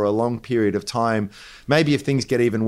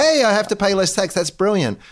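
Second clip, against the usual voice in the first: mock delighted voter; 10 semitones higher than usual. Mocking a tax-cut voter.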